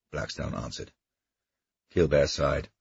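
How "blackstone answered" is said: ordinary delivery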